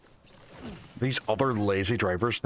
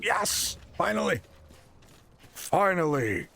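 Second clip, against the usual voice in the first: excited cheer; 7 semitones higher, slower than usual. Real, not acted. Finally catching the stream.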